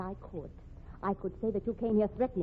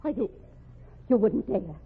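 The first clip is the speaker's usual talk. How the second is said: sharp, louder warning; angry defiance; 4 dB louder than usual. Acted. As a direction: spit it out in outrage. Woman threatened with blackmail.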